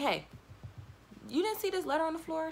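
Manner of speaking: urgent wake-up call. Retold or imitated. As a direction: shake her awake with the news. Waking roommate about letter.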